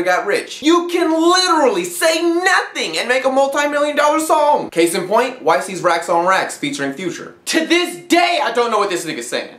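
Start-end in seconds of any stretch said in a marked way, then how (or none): none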